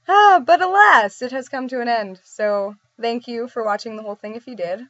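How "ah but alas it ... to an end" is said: stagey farewell; mock-dramatic lament. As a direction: bemoan the ending with hammed-up grandeur. Mock-grand sign-off of the video.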